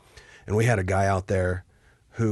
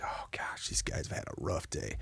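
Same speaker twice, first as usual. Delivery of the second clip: whisper; 6 semitones lower, 12 dB quieter than usual. Retold or imitated. Inner thought watching exhausted soldiers.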